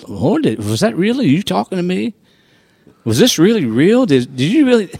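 high, pleading questions rising in doubt; incredulous plea to God. Retold or imitated. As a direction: question heaven in disbelief.

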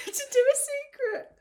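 very high, loud kiddie voice; imitated child. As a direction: squeal it like a small child. Echoes the child's phrase, delighted.

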